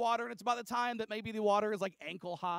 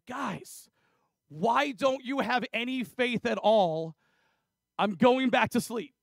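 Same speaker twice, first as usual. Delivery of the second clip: loud, raised, drawn-out reproach; exasperated scolding; 5 semitones higher, 9 dB louder, slower than usual. Acted. Woken Jesus to his disciples, exasperated scolding. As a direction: scold them loudly, fed up.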